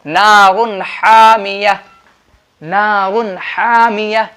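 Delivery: slow नारुन हामिया, clear N, long vowels; deliberate model recitation of नारुन हामिया, no nasal hum; izhar example. Shown as a demonstration. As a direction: draw out हामिया after a distinct N in नारुन. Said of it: "आगे देखिए मिसाल".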